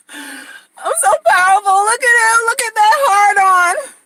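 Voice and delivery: high, loud, cry-like squeal of triumph. Acted. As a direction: shriek with mock pride. Woman gloating in the bedroom.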